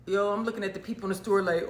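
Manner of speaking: ordinary talk, nothing marked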